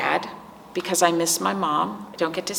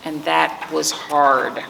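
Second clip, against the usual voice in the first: put-on reading to children. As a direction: let each page breathe for the little ones. Reads picture book to children.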